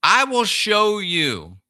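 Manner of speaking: solemn quote of Jesus; voicing Jesus. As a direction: proclaim it like a preacher quoting Jesus. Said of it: "he said".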